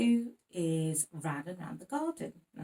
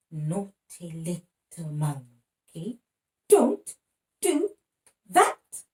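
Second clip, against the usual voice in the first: mock scolding; 11 dB louder, much slower than usual. Parent scolding the monkey in the rhyme, mock stern. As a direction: pretend to scold the naughty monkey.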